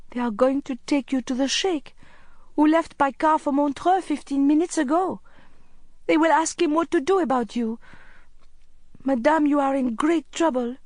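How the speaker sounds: put-on high-pitched, accented child's voice; high, earnest boy delivering grave news; anxious boy Hafez. A narrator's reading. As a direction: warn her urgently as a worried young boy.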